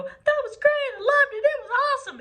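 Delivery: high, loud and fast rave; gushing praise. Retold or imitated. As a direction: gush like a thrilled parent after the show. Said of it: "they're gonna come to you and go".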